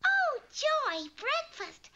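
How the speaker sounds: high, loud burst of joy; delighted cry. Acted. Hungry Goldilocks finding the bears' breakfast, delighted squeal. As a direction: squeal at the sight of food.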